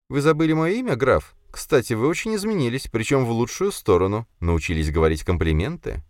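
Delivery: high-pitched, coquettish lady; flirtatious lady. A narrator's reading. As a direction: play a society lady teasing a count.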